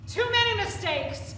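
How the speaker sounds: shouted rebuke, high and loud; loud anguished shout. Acted. Leader shouting at the group as the tunnel collapses. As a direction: yell it over the chaos.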